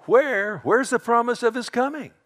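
high-pitched, loud jeer; mocking. Scoffers questioning the promised return, high mocking voice. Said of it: "In these mockers".

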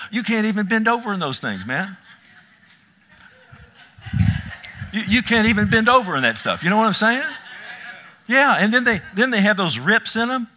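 high, loud, fast laughing tease. Real, not acted. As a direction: laugh through the joke about skinny jeans. Laughing joke about tight jeans.

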